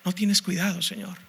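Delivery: a hushed, hurt question to God; quiet reproach. Acted. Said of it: "reclamándole al Señor de esta forma".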